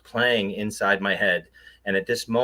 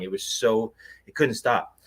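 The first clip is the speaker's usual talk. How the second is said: ordinary talk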